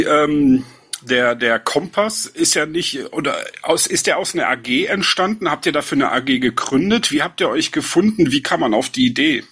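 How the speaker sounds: normal talking voice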